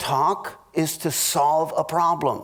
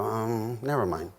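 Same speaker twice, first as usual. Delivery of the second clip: much softer, lower, closing off; soft, low, hurt retreat; withdrawn never mind; 8 semitones lower, 7 dB quieter than usual. Retold or imitated. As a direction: give up and shut down quietly. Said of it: "close up your heart and go".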